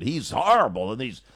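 mock overwrought pundit, pitch swooping; hammed-up emotional outburst; Mika's raw emotion. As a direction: imitate someone near a nervous breakdown on air. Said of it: "that's how she talks".

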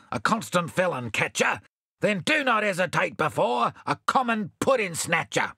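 Bill demanding an arrest, mildly heated; indignant Bill. Acted. Bill to the constable, mildly indignant.

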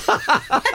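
high laugh breaking out mid-story; burst of laughter. Real, not acted. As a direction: laugh at the memory.

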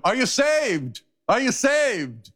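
high, urgent call across the water. Retold or imitated. Man in icy water calling to the dying, high urgent call. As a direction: call out to the drowning, pleading.

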